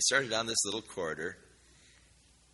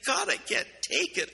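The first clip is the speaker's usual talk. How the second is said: higher, anguished plea to God; desperate cry. Retold or imitated. Girl prays alone in the hall, crying out in despair. Girl's desperate prayer retold.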